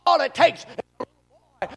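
loud, high pulpit shouting; impassioned revival preaching; full-force preaching. Real, not acted. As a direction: thunder the examples at the congregation, punching each phrase.

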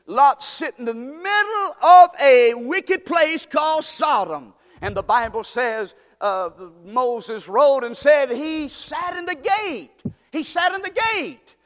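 high, loud, slow preaching cry; preaching at full force. Acted. Preacher telling the church how Lot sat in Sodom, fervent. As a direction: cry it out over the crowd.

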